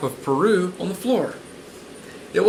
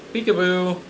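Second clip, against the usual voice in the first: playful peekaboo; 3 semitones higher than usual. Pretend. Plays peekaboo with listeners.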